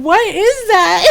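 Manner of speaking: high, slow laughing question; daughter laughing. Retold or imitated. Daughter baffled by her parents' joke, high and laughing. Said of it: "Shai is laughing, going".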